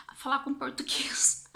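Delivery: hushed, excited whisper to herself; whispered realization. Retold or imitated. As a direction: whisper it like a sudden idea dawning. Retold sudden idea, whispered. (sussurro de quem teve uma ideia)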